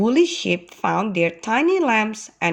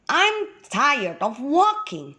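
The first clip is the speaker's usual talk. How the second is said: higher, mildly whiny grumble; a lightly acted weary complaint; complaining; 7 semitones higher than usual. A narrator's reading. Tired walker grumbling on the long march, mildly complaining. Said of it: "Everyone started complaining".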